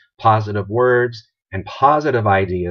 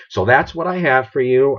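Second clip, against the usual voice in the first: usual speaking voice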